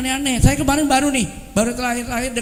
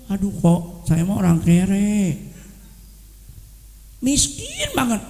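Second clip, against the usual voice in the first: slow, lower mock moan of poverty; self-pitying moan; 4 semitones lower, slower than usual. Friend in Bandung answering a greeting with a slow moan about being poor. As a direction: groan that you are broke. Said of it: "paling suka kesal".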